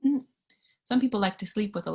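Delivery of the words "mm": usual speaking voice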